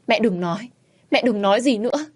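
mildly panicked begging; desperate pleading. A narrator's reading. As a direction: plead and reassure, climbing in pitch. Daughter begs dying mother to rest.

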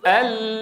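"ال" with the tongue's edges touching; a clear, held "ال"; model lam. Shown as a demonstration. Teacher asking students to repeat a held "ال". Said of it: "قل معي".

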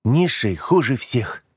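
slow, firm, high-pitched statement; confident. A narrator's reading. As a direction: state it with childish certainty, slowly. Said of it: "уверенно проговорила Габи".

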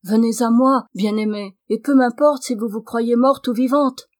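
carried away, voice lifted; impassioned exclamation. A narrator's reading. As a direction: urge the beloved with rising fervour. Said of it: "avec transport".

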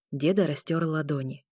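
plain reading voice

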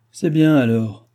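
mildly gruff concession; grumbling. A narrator's reading. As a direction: give in grumpily. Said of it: "grommela la voix".

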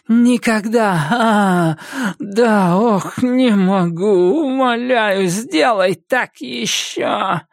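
high, slow moaning pleas; witch's ecstatic moaning. Acted. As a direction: moan as if in pleasure and pain.

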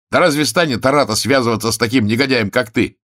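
high, loud scornful challenge; indignant cry. A narrator's reading. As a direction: throw the lie back at him. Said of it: "вскричал он".

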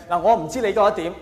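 ordinary talk

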